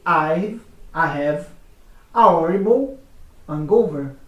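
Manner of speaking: British accent, H in hangover dropped; British English, silent H. Shown as a demonstration. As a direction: say hangover as "angover", no H. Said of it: "O britânico vai dizer".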